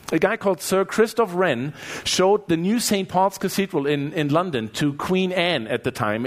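ordinary talk

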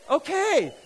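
high, loud, rising to a shout; rally the room at full force; fired-up preaching. Real, not acted. Pastor promising true riches to the congregation, fired-up preaching. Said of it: "I'm so sorry for getting fired up".

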